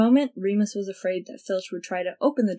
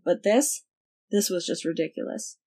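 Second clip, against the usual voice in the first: ordinary delivery, nothing marked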